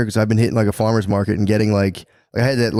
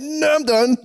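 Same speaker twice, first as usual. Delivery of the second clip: squeaky alarm; slower than usual. Retold or imitated. Man acting out his reaction to his wife, high squeal.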